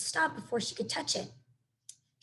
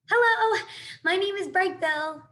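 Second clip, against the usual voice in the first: high, loud call, slow on the name; gosling hailing otters over the surf; shouting over waves; 8 semitones higher, 4 dB louder, slower than usual. A narrator's reading. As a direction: call out across the water. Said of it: "he shouted over the waves".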